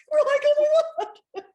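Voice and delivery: very high, loud laughing squeal; laughing disbelief. Retold or imitated. Author and editor in disbelief, squealing with laughter. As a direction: gasp it out mid-laugh.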